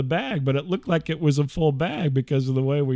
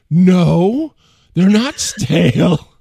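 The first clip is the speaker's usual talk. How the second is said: louder, slow blustering Ted Knight voice; 4 semitones higher, 9 dB louder, much slower than usual. Retold or imitated. Wife voiced pompously, like Ted Knight. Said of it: "your wife sounds like Ted Knight".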